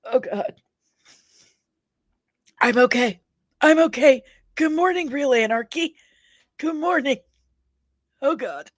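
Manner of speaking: coughing it off between words; recovering after swallowing a gnat; just swallowed a bug. Real, not acted. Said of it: "I think I just swallowed a gnat".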